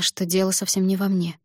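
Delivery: ordinary delivery, nothing marked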